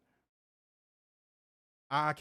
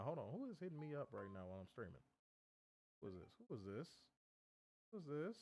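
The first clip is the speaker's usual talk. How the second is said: distracted mumbling; 15 dB quieter than usual. Real, not acted. Distracted reading phone.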